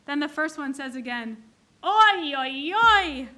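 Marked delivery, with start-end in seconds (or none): 1.8-3.3 escalating oi. Retold or imitated. First woman escalating the kvetch.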